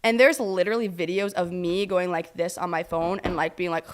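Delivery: normal talking voice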